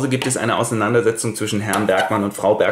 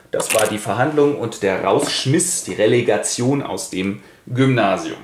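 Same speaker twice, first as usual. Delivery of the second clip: ordinary delivery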